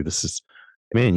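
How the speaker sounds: ordinary talk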